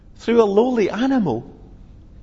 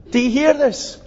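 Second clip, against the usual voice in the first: indignant challenge; 6 semitones higher than usual. Retold or imitated. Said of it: "priests' challenge".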